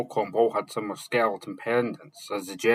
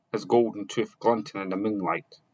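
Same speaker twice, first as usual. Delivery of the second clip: usual speaking voice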